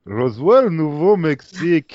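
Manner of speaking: Roswell, Nouveau-Mexique in a put-on Russian accent; slow, heavy Russian accent on Nouveau-Mexique; russian accent in french. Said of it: "On fait l'accent russe".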